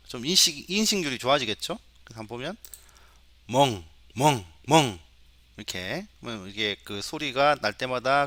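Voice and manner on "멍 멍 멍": spoken, evenly spaced dog woofs, a bit loud; dog bark imitation. Shown as a demonstration. Teacher recording dog samples for the computer, imitated barking. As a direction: imitate a dog with short, separate barks. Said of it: "이런 소리는 강아지야".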